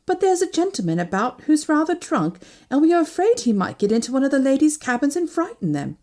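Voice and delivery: high, polite stewardess voice; apologetic stewardess. Acted. Stewardess warns passenger of a drunk, apologetic. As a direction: raise the pitch, deferential and concerned.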